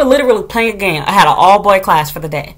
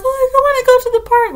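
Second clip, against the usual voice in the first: high-pitched mock child whine; sad whiny kid. Children told their park time is over, mock sad whine. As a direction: whine it like a disappointed little kid. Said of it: "so then they got sad".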